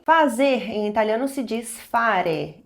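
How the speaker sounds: usual speaking voice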